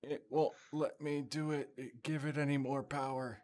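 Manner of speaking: fretful starship engineer at the console; Scotty. Acted. Star Trek engineer bit.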